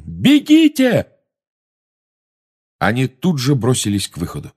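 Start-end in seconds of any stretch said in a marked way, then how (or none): 0.0-1.1 yelling. Acted. Harry yells at students.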